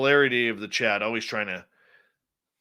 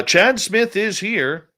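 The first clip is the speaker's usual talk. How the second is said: grand announcement; 9 semitones higher, 7 dB louder, slower than usual. Pretend. Jokingly announcing chat member.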